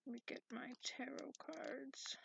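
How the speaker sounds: soft whisper to himself; muttered whisper. Acted. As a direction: whisper it as a private aside. Mutters while fetching cards.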